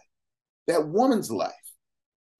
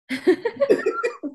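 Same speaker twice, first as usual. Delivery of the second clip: high burst of laughter. Real, not acted. Host laughing at the Toastmasters verdict, high burst of laughter. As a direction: laugh out at the memory.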